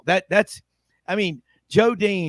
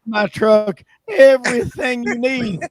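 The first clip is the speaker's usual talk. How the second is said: higher-pitched, louder sitcom peddler impersonation; Mister Haney; 5 semitones higher, 6 dB louder than usual. Retold or imitated. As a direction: pitch it like a salesman offering his wares. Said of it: "was the name of Mister Haney. He goes".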